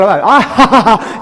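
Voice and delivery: mock burst of "ha ha ha"; imitated laugh. Imitating the laugh after interpreting.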